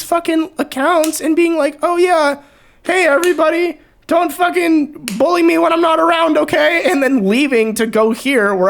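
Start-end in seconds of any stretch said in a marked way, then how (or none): 1.8-6.8 mock pleading greeting. Mock greeting to old accounts.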